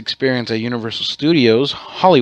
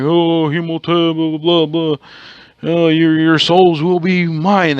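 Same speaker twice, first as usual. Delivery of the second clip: slow, higher, hammed-up movie priest incantation; mock mummy villain; 4 semitones higher, slower than usual. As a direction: drag out the curse like a B-movie mummy.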